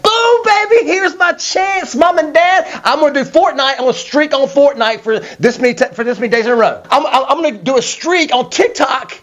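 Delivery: put-on high, eager kid voice; excited kid. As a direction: act like a kid thrilled to game all day. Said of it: "You're like".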